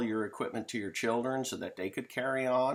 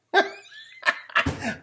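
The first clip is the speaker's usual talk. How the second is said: high, loud gleeful cackle; gloating laugh; evil cackle; 7 dB louder than usual. Real, not acted. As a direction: cackle like you got the last laugh. Laughs at own joke.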